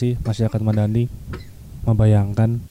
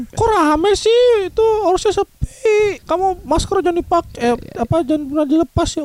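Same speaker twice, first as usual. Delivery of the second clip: high-pitched worried mother; mom's voice. Retold or imitated. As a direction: voice your mom, pitched way up. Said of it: "mak gua langsung".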